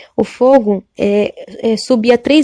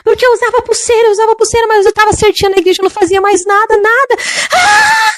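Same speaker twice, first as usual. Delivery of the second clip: high anguished crying shout ending in a scream; anguished screaming from hell; 12 semitones higher than usual. Retold or imitated. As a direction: cry out like a damned girl begging in the flames. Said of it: "e ela gritou".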